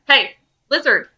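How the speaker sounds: loud challenging call; Taco yelling at the wyvern. Acted. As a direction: shout to draw the beast's attention. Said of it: "he yells as loud as he can".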